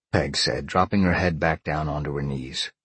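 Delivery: plain reading voice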